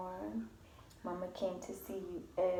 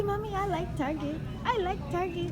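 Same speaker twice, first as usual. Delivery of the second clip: put-on baby voice; 11 semitones higher, 8 dB louder than usual.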